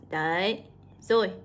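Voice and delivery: ordinary delivery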